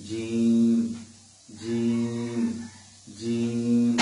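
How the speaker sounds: জিম said from the middle of the tongue, firm; Arabic jim demo. Shown as a demonstration. Teacher demonstrating jim for students to copy. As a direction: drill জিম clearly. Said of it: "মাঝখান থেকে আমরা জিমকে আদায় করব".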